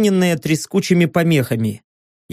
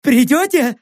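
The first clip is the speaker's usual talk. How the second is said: gabbling; 7 semitones higher than usual. Acted. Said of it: "затараторил".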